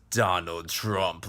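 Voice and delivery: a hint of a slow Terminator accent, low; lightly flat robotic Schwarzenegger, drawn out; Schwarzenegger style. Café owner greeting an American, a touch of Terminator accent. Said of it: "she said it like the Exterminator almost, Arnold Schwarzenegger style".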